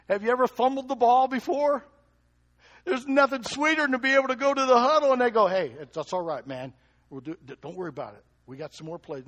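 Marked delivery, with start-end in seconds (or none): 5.5-9.3 gentle encouragement. Acted. Teammates encouraging in the huddle.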